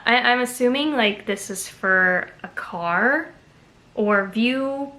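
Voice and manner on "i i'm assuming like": usual speaking voice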